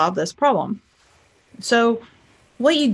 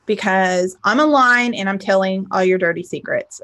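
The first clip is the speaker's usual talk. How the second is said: ordinary talk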